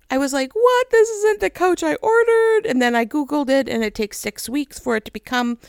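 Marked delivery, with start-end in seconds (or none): 0.5-2.6 much higher, louder dismayed cry of disbelief; upset disbelief. Retold or imitated. Woman finding her new couch rock hard, dismayed cry. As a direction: wail it like the couch betrayed you. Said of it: "and I cried".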